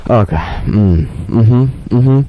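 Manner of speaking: lightly voiced contented hums; mock back-rub moans. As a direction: hum like someone getting a massage. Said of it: "I make a lot of sounds like this".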